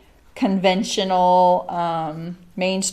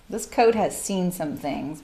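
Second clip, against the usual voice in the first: ordinary talk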